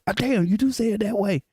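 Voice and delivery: high, mildly acted surprise; surprised realization. Announcer calling to check a name, mock surprised. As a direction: imitate someone finding out, pitched well up. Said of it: "He got to call somebody".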